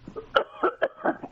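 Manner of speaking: a second quick cough. Real, not acted. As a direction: clear the throat with a cough. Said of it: "excuse me while I cough".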